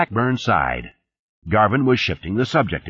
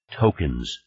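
lower, mildly dismissive word; 6 semitones lower, 3 dB quieter than usual. A narrator's reading. Boss waves away Blackburn's examples, dismissively. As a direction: brush the objection aside. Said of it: "said with a dismissing wave".

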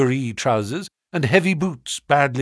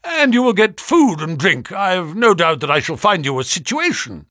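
loud, high reassurance; 6 semitones higher, 4 dB louder than usual. A narrator's reading. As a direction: say it loudly for show. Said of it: "he continued out loud".

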